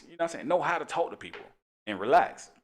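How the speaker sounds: ordinary delivery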